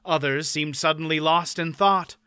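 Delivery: ordinary delivery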